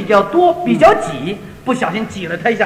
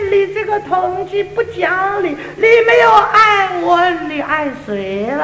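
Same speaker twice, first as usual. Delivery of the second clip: annoyed Cantonese woman; 6 semitones higher, slower than usual. Retold or imitated. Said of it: "他一听也不高兴".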